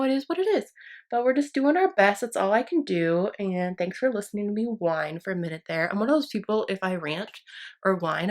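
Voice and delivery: ordinary talk, nothing marked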